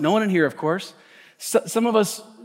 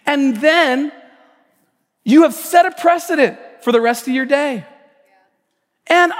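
loud, building sermon delivery; preaching; 8 semitones higher, 4 dB louder than usual. Acted. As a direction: press the point to the congregation. Preaching with rising force.

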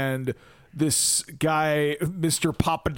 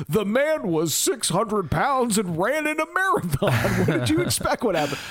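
higher-pitched, hammed-up exasperation; mock incredulous retort; 6 semitones higher than usual. John Candy's character answering his wife, mock incredulous.

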